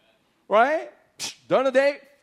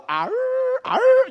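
high loud hammed-up wail of pain; mock pained cry; 13 semitones higher, 6 dB louder than usual. Preacher mimics circumcised soldiers, high pained wail. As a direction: howl like the men in pain. Men crying after circumcision.

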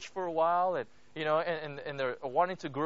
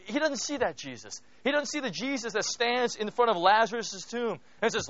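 normal talking voice

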